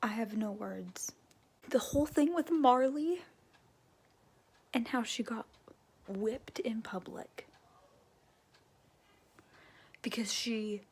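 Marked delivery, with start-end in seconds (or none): 0.0-1.1 breathy whisper, no voice; speechless, barely said; stunned whisper. Real, not acted. Stunned after shock. 4.7-10.8 hushed dismay. Real, not acted. Upset recounting whipping.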